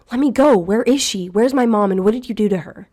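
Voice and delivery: loud, high furious yelling; furious screaming. Acted. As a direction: scream at the stranger in terror and rage. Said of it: "my throat stung from screaming".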